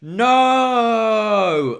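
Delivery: long, high, loud anguished no; Darth Vader no. Retold or imitated. Host voicing Jensen realizing he's friend-zoned, loud Vader-style no. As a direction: howl it like Vader's despair. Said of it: "Like Darth Vader at the end of Revenge".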